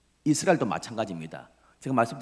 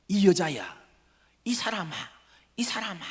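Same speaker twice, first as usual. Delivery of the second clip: high-pitched protests, one after another; mock denials; 7 semitones higher than usual. Peter fending off a woman and two men, protesting. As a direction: replay each brush-off in raised pitch. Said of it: "부인합니다".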